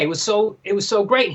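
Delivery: usual speaking voice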